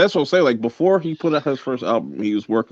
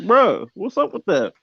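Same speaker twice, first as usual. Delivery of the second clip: high-pitched mock confrontation; retold challenge; 11 semitones higher than usual. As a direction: imitate calling someone out, voice pitched up. Retold confrontation over a diss.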